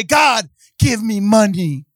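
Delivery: slow, whiny mock plea; mock whiny prayer. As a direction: sound needy and petty. Mocking Christians' prayer.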